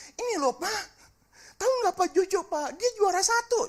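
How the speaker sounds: high, loud, thrilled wife on the phone; excited wife. Retold or imitated. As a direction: burst out with the good news. Wife phoning with news.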